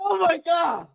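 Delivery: ordinary delivery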